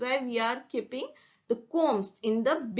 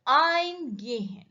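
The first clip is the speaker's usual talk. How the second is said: "Eingehen" with EIN stretched long and raised; slow "Eingehen", heavy first syllable; stress on EIN; 4 semitones higher, slower than usual. Shown as a demonstration. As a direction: hit the prefix "Ein" high, then let "gehen" drop. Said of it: "In ein".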